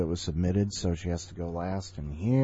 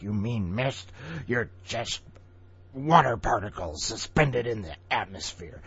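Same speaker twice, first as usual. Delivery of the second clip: mock scolding; 4 semitones higher than usual. Mock scolding the story's mist.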